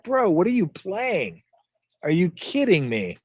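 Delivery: a hint of a scoffing teenager, high and laughing; mocking kid. Mocking kids who laugh at the old game, light scoffing voice. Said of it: "Kids nowadays would laugh at you".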